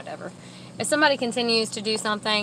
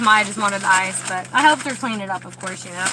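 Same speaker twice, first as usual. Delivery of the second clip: ordinary talk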